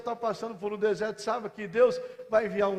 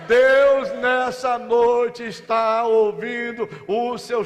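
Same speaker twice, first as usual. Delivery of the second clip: loud, high, fervent sermon cry; rousing pulpit proclamation; full-force preaching; 5 semitones higher, 10 dB louder, slower than usual. Acted. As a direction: preach it out to the whole church.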